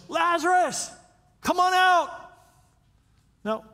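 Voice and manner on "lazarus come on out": high, loud commanding call. Acted. Jesus calling into the tomb, loud commanding shout. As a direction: call him out of the tomb with full voice. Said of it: "he called out in a loud voice".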